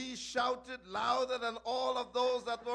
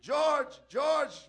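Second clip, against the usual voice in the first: loud, higher call of a name; wife crying out to stop her husband; sharp loud cry to George; wife shouting; 4 semitones higher, 6 dB louder than usual. Acted. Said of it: "his wife Germaine shouted".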